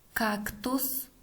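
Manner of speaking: word by word with long pauses; dictating. Shown as a demonstration. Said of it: "Следующее предложение". (диктует по словам)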